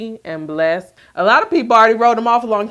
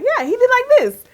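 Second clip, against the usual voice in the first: put-on very high, louder little-kid voice; eager toddler; 6 dB louder than usual. Mother imitating her son showing grandpa's hug, squeaky high. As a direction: show it off like an excited small boy. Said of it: "he was like".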